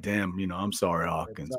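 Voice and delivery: mock sympathy. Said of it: "we were like".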